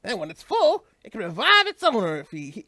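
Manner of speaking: put-on cheerful Southern US accent for the little companion. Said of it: "I'm suddenly giving him a southern accent".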